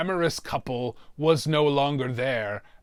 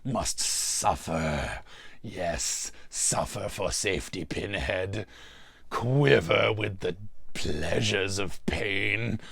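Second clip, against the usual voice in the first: low, slow sinister horror villain; Pinhead-style sinister drawl; menacing Cenobite; 4 semitones lower, 4 dB quieter, slower than usual. Acted. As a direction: drag the words out like a demon promising pain.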